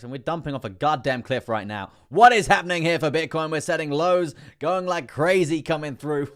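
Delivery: building to a high, loud shout; worked-up shouting, stretched out at the end; excited yelling. Real, not acted. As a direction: holler it like a live crash call. Streamer exclaims as price plunges.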